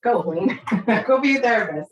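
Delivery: laughing through a loud, high mock command; cheerful divine order broken by laughter; God's words, laughing. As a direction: deliver the call from above with a laugh. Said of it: "God said".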